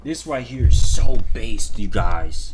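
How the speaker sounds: loud hammed-up declaration; sarcastic loud hype in the rage baiter's voice; mock shout. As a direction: belt it out like fake hype.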